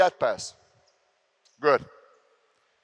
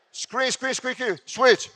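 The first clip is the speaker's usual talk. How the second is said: calling screen; 7 semitones higher, slower than usual. Shown as a demonstration. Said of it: "let me do it".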